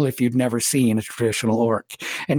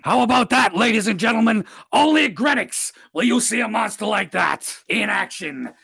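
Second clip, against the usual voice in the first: ringmaster announcer; 6 semitones higher than usual. Acted. Grenick to the arena crowd, big ringmaster call. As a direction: sell the fight like a carnival barker.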